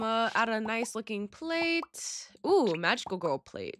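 ordinary delivery

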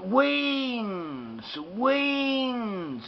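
WINGS said as a long "weenz"; vowel swapped to E. Shown as a demonstration. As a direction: slowly stretch the EE in "weenz". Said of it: "the E sound".

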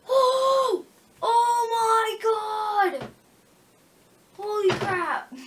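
high, loud astonished cry; amazed shout. Real, not acted. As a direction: shout in disbelief. Amazed at gameplay moment.

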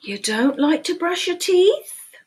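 lively picture-book read-aloud, questions pitched high; animated storytime voice with playful questions; reading to children. Acted. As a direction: read it like storytime for little ones.

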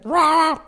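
high, strangled croak; swallowed-voice "ra". Shown as a demonstration. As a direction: choke out a short "ra". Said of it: "Van a hacer".

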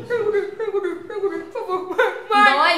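laughing through a mouthful; talking while still chewing; mouth full. Real, not acted. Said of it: "Não, engole primeiro".

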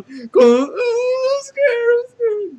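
very high, loud crying shriek; hammed-up sobbing panic; panicked wail. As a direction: wail it in terror. AI character panics, says it's scared.

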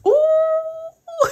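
a high, delighted ooh; excited squeal. Real, not acted. Squealing over a cute doll.

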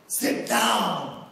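loud, low-pitched order; commanding. Retold or imitated. Absent-minded professor to student sneaking out, booming command. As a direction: drop the pitch and boom it out.

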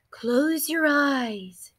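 slow, soft, calming hush; slow hypnotic calm; soothing therapist. A narrator's reading. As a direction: lull the listener like a therapist. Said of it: "therapist soothing".

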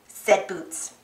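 ordinary delivery, nothing marked

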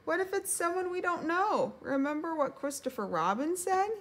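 high-pitched worried little voice; anxious warning. A narrator's reading. Piglet warning Pooh about the door, anxious.

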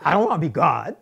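loud, emphatic mock protest; imitated actor's protest. Director imitating the actor refusing a note, protesting. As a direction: imitate a star balking, punched up with swooping pitch. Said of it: "That's how he said it".

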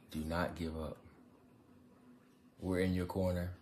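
ordinary talk, nothing marked

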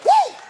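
high, loud preacher whoop; real excited whoop from the pulpit; ecstatic woo. Real, not acted. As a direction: let out a joyful shout.

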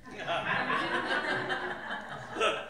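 a quiet, unvoiced giggle; breathy laugh. Real, not acted. Narrator finding the grass-like sedge funny, soft giggle. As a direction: let the laugh escape on breath.

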